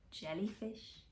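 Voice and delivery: ordinary delivery, nothing marked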